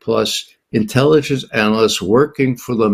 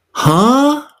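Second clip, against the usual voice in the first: incredulous huh; 13 semitones higher, 4 dB louder than usual. Real, not acted. Disbelief at the DNI's claim.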